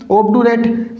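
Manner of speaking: ऑब्डुरेट with a hard "du" in the middle; mispronounced obdurate. Shown as a demonstration. Teacher setting up the wrong reading to correct, ऑब्डुरेट with hard "du". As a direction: say ऑब्डुरेट as "ob-du-rate", plain d. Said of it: "ऑब्डुरेट नहीं होगा".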